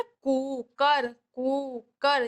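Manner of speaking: slow "कुकर" with koo drawn out; unhurried koo-kar for "कुकर"; long oo sound. Shown as a demonstration. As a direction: stretch the first syllable of "कुकर". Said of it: "यानी कि आराम से बोलेगी ये".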